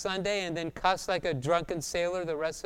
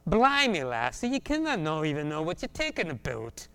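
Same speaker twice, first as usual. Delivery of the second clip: put-on Scottish accent. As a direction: play Peter as a Scotsman, exclaiming then settling. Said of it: "the Galileans are like Scotsmen".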